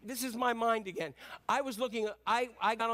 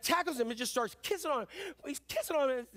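normal talking voice

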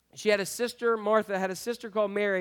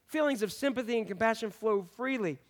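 normal talking voice

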